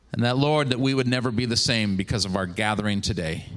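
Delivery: solemn prayer voice, falling low; closing a prayer to the congregation, hushed and reverent. Acted. Closing prayer before sermon.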